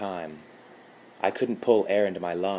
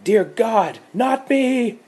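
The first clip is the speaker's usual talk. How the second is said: high, loud terrified scream; 10 dB louder, slower than usual. Acted. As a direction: shriek it in terror. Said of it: "She screamed".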